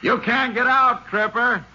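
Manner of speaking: slow, high call carried across the distance. Acted. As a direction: make him hear you inside the mine. Calling into the mine.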